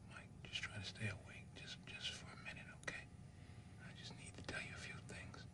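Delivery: soft unvoiced whisper at a bedside; hushed whisper so the boy can rest. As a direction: breathe the words to a sick child. Father at dying son's bed.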